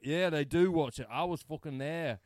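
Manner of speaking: loud, high working-class Mancunian voice; Manchester accent. Retold or imitated. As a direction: imitate a Manchester bloke shouting in. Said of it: "British dude from Manchester".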